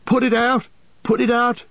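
very high, strained plea; panicked cry. A narrator's reading. Merry trapped as the fire is lit, crying out in alarm. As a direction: cry out in panic at the top of the range. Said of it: "cried Merry".

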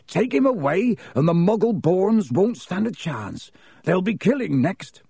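high-pitched, agitated protest; yelling Hagrid. A narrator's reading. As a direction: protest hotly, voice pitched right up. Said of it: "yelled Hagrid".

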